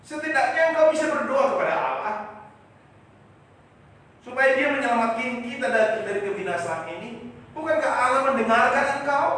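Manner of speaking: high, loud pleading; loud plea. Retold or imitated. Disciples beg Jesus to pray, loud and high. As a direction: urge him to pray, loudly.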